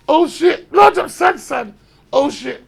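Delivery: loud, hyped-up crowd reaction; big it up like impressed mates; excited hype shout. Retold or imitated.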